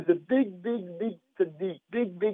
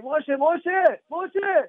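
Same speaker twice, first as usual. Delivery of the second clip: calling a name higher across a street; 7 semitones higher, 3 dB louder, much slower than usual. Retold or imitated. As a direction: call out to a friend. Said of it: "somebody said".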